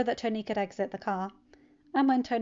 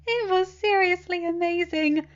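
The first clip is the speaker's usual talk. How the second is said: put-on high, louder, bright-eyed teen voice; cheerful teen; 10 semitones higher, 8 dB louder than usual. Teen telling his uncle about his weekend, cheerful and high. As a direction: bounce through it like an eager kid.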